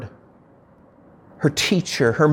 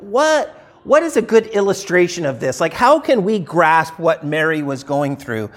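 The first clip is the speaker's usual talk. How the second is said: usual speaking voice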